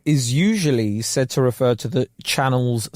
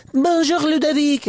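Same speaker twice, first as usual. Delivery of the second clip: very high chirpy French tape voice; high bright exam recording; 13 semitones higher than usual. Retold or imitated. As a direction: sing it out like a cheery language tape. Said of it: "Super chirpy voices".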